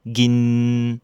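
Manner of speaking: "Gin" with a held, ringing n; "Gin" said in Thai, mid tone; Thai word. Shown as a demonstration. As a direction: let "Gin" resonate. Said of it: "est une syllabe vivante".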